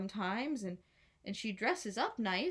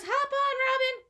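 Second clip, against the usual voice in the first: high, loud eager shout; excited call; 5 dB louder than usual. A narrator's reading. Maid Marian riding up to rescue Robin, high eager call. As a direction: call him onto the horse. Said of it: "she says".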